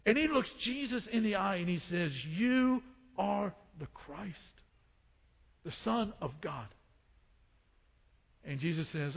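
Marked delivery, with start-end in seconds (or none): 2.2-6.7 hushed sincere confession. Acted. Peter's hushed confession to Jesus.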